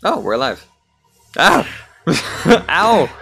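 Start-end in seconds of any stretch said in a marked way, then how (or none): 1.4-1.7 short, loud, high yelp; startled yelp. Real, not acted. Reacting to getting hit in game. 2.7-3.1 loud, high cry of ow; pained yelp. Real, not acted. Reacting to getting hit in game.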